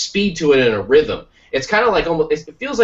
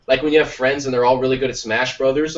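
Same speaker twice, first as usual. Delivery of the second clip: usual speaking voice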